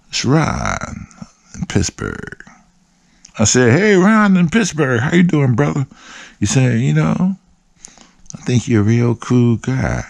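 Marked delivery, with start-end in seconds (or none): 0.1-2.4 low, subdued caller introducing himself; Ron. Retold or imitated. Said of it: "that's how he talks". 3.8-5.9 high-pitched, enthusiastic hello on a call; hearty greeting. Retold or imitated. Retold greeting to caller Ron. 6.8-10.0 lightly voiced caller, pitched up; Ron. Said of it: "that's how he talks".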